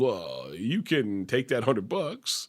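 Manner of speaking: slow, drawn-out mock baritone; Riley Martin. Imitating a radio guest refusing a hundred bucks, slow and low. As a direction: linger over each word of the refusal. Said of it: "He goes".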